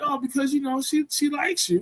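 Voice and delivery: imitating a parent gently pushing the kid; mock sweet parent. Parent explaining to the visitor, lightly mocked and high-pitched. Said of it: "they be like".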